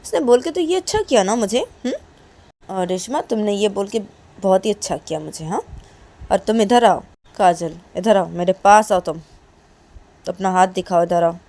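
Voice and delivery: loud, stern calling her over; scolding mother. Acted. Mother scolding her daughter for shouting, loud and stern. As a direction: order the daughter to come here. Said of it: "काजल की mummy काजल को बोलती".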